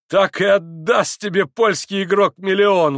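high, loud, drawn-out scoff; loud exclamation. A narrator's reading. Mitya doubting the Polish gambler, loud exclamation. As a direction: exclaim it with full force. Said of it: "воскликнул Митя".

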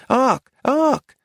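put-on high-pitched small boy calling out; child's shout. A narrator's reading. Small boy recognizing Arcady, shouted high. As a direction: call the name like an excited small boy.

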